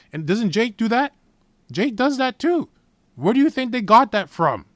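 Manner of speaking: loud, high-pitched rhetorical challenge; real worked-up sermon shout; heated preaching. Real, not acted. Preacher challenging listeners in rant.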